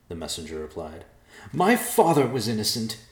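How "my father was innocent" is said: louder, higher, seething; through clenched teeth. Acted. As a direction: grit your teeth and seethe. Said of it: "said through clenched teeth".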